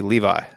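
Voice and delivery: "Levi" as LEE-vye, ending like eye; English Levi. Shown as a demonstration. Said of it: "in English, that first one".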